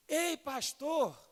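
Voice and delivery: high, slow shout across the room; child's shout. Retold or imitated. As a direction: yell like a little boy calling. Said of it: "Ele gritou". (grito de criança, agudo e lento)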